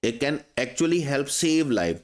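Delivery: very slow, each word separated; teacher reading a sentence slowly; slow dictation. Shown as a demonstration. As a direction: spell it out slowly for the class. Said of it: "sentence कैसे चलेगा?".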